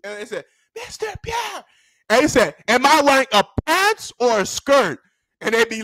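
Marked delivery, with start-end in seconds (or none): 0.8-1.6 high, soft pupils' chorus; small, dutiful high voice; obedient class. Retold or imitated. Class answers teacher in chorus. 2.7-5.0 raised-pitch, emphatic quiz to the class; mock-stern drilling voice; strict teacher.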